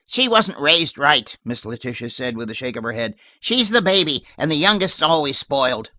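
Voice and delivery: put-on old lady shooing her maid off, tart and loud; shrill old lady. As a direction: bark it like a cranky old lady. Miss Letitia voiced.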